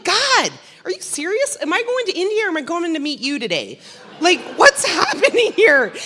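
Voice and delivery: high, loud, rushed cry to God; retelling a rising airplane panic; panicked plea. Retold or imitated. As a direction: blurt it out in a half-laughing panic. Panicking on a broken plane.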